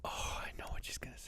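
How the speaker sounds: hushed eager whisper. Real, not acted. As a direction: whisper it under your breath. Whispered aside.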